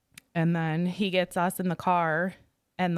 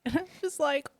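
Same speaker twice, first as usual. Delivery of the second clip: ordinary talk, nothing marked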